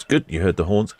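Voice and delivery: ordinary delivery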